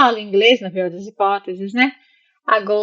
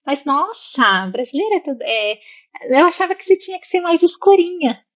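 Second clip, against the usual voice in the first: prejudiced surprise; 7 semitones higher than usual. Retold or imitated. Local doubting she is Brazilian, prejudiced surprise. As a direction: imitate a tactless local's amazed remark in a raised pitch. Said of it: "Aquela, aquele preconceito".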